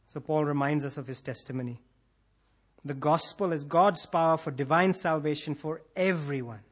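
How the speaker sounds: ordinary delivery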